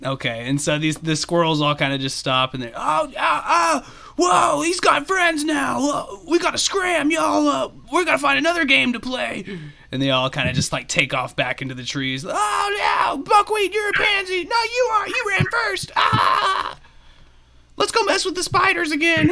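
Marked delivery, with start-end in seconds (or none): 2.8-9.4 high squeaky panicked squirrel voice; alarmed squirrels. Acted. Squirrels intimidated by the treant's friends, high alarm. Frightened squirrels decide to flee. 12.3-19.3 very high squeaky squealing voices; bickering squirrels. Acted. Squirrels fleeing and blaming each other, high squealing.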